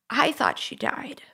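almost whispered, breath only; barely voicing the fear as it comes back; hushed. Real, not acted. Recalling mother's collapse on mountain.

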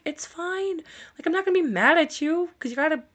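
high-pitched, placating retold voice; mock reassurance. Caregiver telling apologetic dad it's fine, high placating voice. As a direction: coo it forgivingly, pitched well up.

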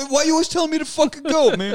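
high, quick, laughing complaint; high-pitched, hurried playful objection; laughing mock protest.

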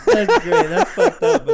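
loud amused reaction to the prank; delighted. Real, not acted. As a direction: burst out in delight.

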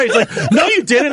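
ordinary talk, nothing marked

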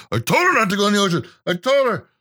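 fast, high frantic muttering; frantic pacing husband. Retold or imitated. Husband pacing the shore, frantically repeating himself. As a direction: gabble it like a man pacing the beach. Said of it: "I'd be that guy just pacing".

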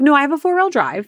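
high-pitched defensive comeback; retold protest. Driver denying she is one of the Mercedes people, defensive protest. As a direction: protest your innocence, pitched well up.

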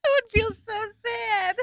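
real voice breaking near tears; tearful. Real, not acted. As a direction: let it crack on the edge of crying. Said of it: "I'm gonna start crying again".

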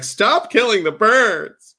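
exasperated slow begging; pleading with the cat. Retold or imitated. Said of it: "and I'm just like".

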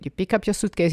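ordinary delivery

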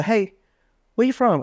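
high-pitched flirty hello; acted pick-up greeting, high and light; chat-up opener.

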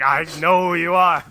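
loud, high mock monster threat; hammed-up threatening holler; pretend menacing yell. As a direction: shout it like a goofy monster grabbing someone. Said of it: "grab him and be like".

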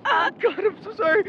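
high, tearful cry of apology. Acted. Tending Daniel's wound, crying out sorry. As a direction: break down while saying sorry.

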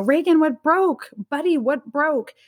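mild imitation of a worried mom calling out; retold scream. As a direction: call out in alarm, without really shouting. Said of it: "I screamed down".

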